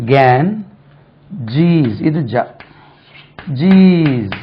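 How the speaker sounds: கேன், then a long ஜீஸ், with gaps; syllable by syllable. Shown as a demonstration. Teacher splits the river's name for learners, slow syllable demo. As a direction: break it into கேன் and ஜீஸ், "jeez" held long. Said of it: "இது கேஞ்சஸ் இல்ல".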